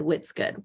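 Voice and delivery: WHAT'S with a short i, like "wits"; slipped WHAT'S as "Whit's". Shown as a demonstration. Host catching her own slip, WHAT'S said as "Whit's". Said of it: "I just said, "What's good?".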